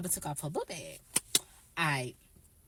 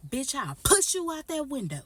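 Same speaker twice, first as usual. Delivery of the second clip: mock angry threat; 7 semitones higher, 4 dB louder than usual. Retold threat in a quarrel.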